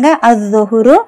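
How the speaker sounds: அத் தொஹுரு with the l silent, first consonant doubled; no l sound in அத் தொஹுரு; silent lam. Shown as a demonstration. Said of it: "லாம் உச்சரிக்கப்படலை".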